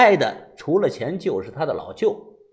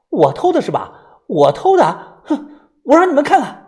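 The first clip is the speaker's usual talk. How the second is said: loud, affronted challenge; huffy, voice rising high; fuming; 9 semitones higher, 6 dB louder than usual. A narrator's reading. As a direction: throw the accusation back angrily. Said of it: "气冲冲地回到房里".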